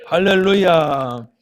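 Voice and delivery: slow, stretched, booming hallelujah; drawn-out shout of praise; loud hallelujah call. Real, not acted. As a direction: call it out to the whole congregation. Pastor opens with a call.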